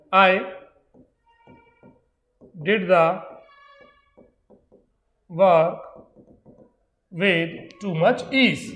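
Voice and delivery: very slow, stretched out as if writing on the board; drawn-out example read to students; slow dictation. Shown as a demonstration. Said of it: "teacher writes example sentence".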